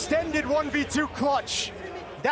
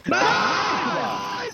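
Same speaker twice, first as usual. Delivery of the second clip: loud cheer of delight; excited shout; 4 semitones lower, 4 dB louder than usual. Real, not acted. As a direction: shout it out in triumph. Player celebrates on comms.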